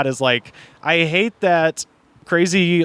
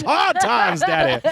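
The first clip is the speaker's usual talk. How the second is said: much higher, laughing through a silly put-on voice; laughing mock lament; 11 semitones higher, slower than usual. Cracking up at her own axe joke, high laughing mock lament. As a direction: crack up while pushing the voice way up.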